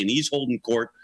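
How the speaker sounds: usual speaking voice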